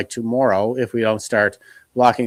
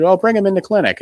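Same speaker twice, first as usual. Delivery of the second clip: a teasing, sing-song doctor, pitched up; mocking doctor; 9 semitones higher, 3 dB louder, faster than usual. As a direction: echo her taunting him. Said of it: "she was making fun of me".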